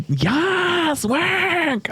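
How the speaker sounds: high, drawn-out campy "yes, work"; camp cheer. Retold or imitated. Big city gays cheering, high and campy. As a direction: cheer like a queen in the city crowd. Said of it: "they're like".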